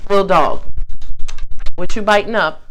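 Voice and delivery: loud sing-song call to the dog. Real, not acted. Owner calling her dog, loud sing-song call. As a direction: call out loudly across the room.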